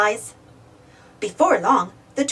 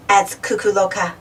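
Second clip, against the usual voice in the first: ordinary delivery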